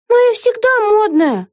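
high, slow, offended protest; protest, insulted; indignant. A narrator's reading. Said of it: "возмутилась Вега". (возмущённо)

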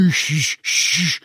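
long sharp shushes; urgent shushing. A narrator's reading. Hagrid silences Ron about the stone, urgent shushing. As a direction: cut them off with two drawn-out hushes. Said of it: "صرخ هاغريد".

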